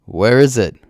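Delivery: a restrained shriek of rising panic; hysteric shriek. A narrator's reading. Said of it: "Quiga shrieks, becoming hysteric".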